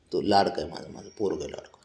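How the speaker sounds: soft tender murmur to the pet; tender reassurance. Real, not acted. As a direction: reassure him softly and low.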